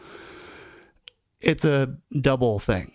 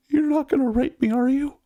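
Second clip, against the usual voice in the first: much higher, small pleading voice; imitated tearful kid. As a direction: play a scared crying youngster, pitched way up. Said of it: "tear-stained, snot-stained, giant mess".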